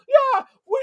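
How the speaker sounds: sarcastic high-pitched team impression; mocking Toronto mentality. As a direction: parody a team happy to lose. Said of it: "That's their mentality".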